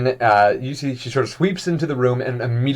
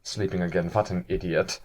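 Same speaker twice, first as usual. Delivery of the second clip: softer, low scornful aside; disdainful aside; 7 semitones lower, 11 dB quieter than usual. Acted. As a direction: mutter it with contempt under the breath. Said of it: "she goes".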